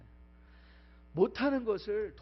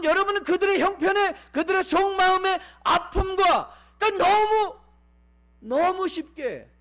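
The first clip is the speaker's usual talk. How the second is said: high, loud pulpit cry; a pastor at full force, voice ringing high; fiery preaching; 12 semitones higher, 13 dB louder than usual. Acted. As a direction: preach it out to the whole hall.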